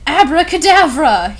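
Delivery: loud, high incantation; shouted magic word; yelled spell. Acted. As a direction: cast it with a yell. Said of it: "yelled at Snape and Lupin".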